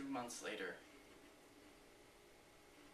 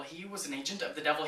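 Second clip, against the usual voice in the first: louder, insistent repeat of a preacher's accusation; retold pulpit scream; 3 semitones higher, 7 dB louder than usual. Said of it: "he stood David at the pulpit and screamed".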